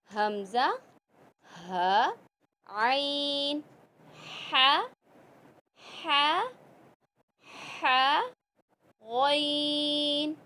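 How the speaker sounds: each throat letter said slowly and distinctly from the throat; throat letters demo. Shown as a demonstration. Teacher demonstrating throat letters to students, drawn out and distinct. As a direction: sound out हमज़ा, ह, आइन, ह, गॉइन one by one, drawn out. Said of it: "इन हरूफ की pronunciation में पूरा आपने ख्याल रखना है".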